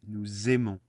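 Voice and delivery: "Nous aimons" linked with a Z sound before "aimons"; liaison Z. Shown as a demonstration. Teacher showing learners the hidden Z, "Nous aimons" with liaison. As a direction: say "Nous aimons" as noo-zay-mon, Z joining the words. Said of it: "there is a Z in there".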